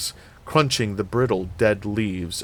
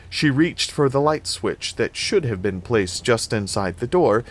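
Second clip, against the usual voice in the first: ordinary delivery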